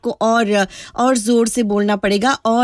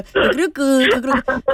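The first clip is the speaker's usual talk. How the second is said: mock hide-and-seek crow; 2 semitones higher than usual. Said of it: "hide and seek खेल रहे हो".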